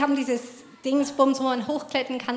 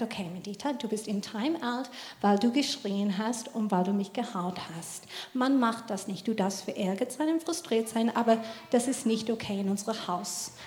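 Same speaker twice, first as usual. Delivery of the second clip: much softer, calm firm explanation; calm quiet mother; 3 semitones lower, 5 dB quieter than usual. Retold or imitated. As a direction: soften right down, steady and patient. Mutter erklärt Time-out ruhig. (Mutter erklärt der Tochter leise und ruhig das Time-out)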